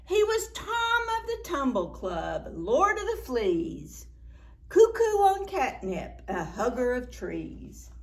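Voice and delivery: put-on rhythmic, playful read-aloud to kids; lively storytime reading. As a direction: bounce the rhymes for the children. Reads rhyming picture book.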